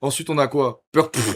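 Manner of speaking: usual speaking voice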